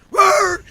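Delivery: high, loud bark; explosive excited woof; imitated dog bark. Dog hearing his owner's call, loud imitated bark.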